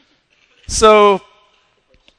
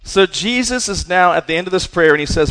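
normal talking voice

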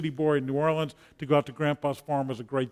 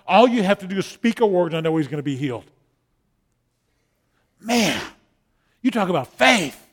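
loud, fervent preaching, higher and louder; preaching at full force; 5 semitones higher, 7 dB louder than usual. Acted. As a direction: drive the story home to the congregation.

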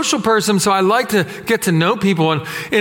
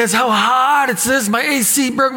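higher-pitched grumbling in imitation; put-on complaint, raised in pitch; mock complaining; 6 semitones higher than usual. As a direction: imitate a woman griping, pitched up. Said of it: "she's complaining".

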